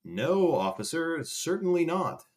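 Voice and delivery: high, slow, overly polite denial; prim, high innocent voice to the cop; mock innocent. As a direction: play the sweet innocent to the officer.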